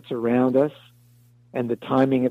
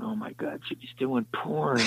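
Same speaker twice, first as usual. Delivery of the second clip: shocked whisper; 9 dB quieter than usual. Retold or imitated. Shocked private thought retold.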